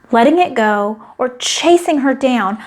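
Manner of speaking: ordinary delivery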